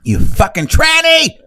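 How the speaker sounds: high, slow mock screech; mock shriek. Voicing the girls mad about the BMW, high slow screech.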